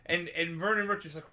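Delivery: usual speaking voice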